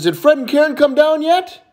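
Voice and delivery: put-on high childlike question; little boy. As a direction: ask Mom like an eager kid. Little boy asks his mom.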